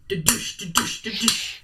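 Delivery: unvoiced da-douche thuds; a small creature bouncing heard from upstairs; whispered bouncing sound. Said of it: "It sounds a lot like a".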